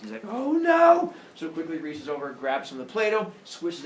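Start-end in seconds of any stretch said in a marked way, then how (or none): 0.3-1.1 high, loud mock alarm; panicked realization. Epimetheus realizing he forgot humans, panicked. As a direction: wail it in comic dismay.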